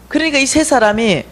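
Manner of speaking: ordinary talk, nothing marked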